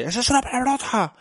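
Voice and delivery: put-on high child's voice; squeaky kid catching a swear; little girl scolding her father; daughter calling him out. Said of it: "me marcan".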